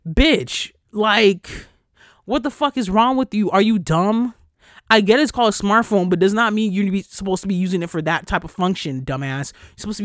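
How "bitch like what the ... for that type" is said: louder and higher, berating; angry rant. Real, not acted. Podcaster chewing out the friends who filmed, loud and angry. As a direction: tear into them, fed up. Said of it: "I know I sound so pissed off".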